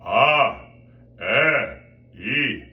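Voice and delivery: loud, buzzing, projected vowels; vowel demo with a carrying buzz; resonant vowels. Shown as a demonstration. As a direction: ring out each vowel separately with resonance. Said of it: "buzzing quality that you can hear that sounds like resonance".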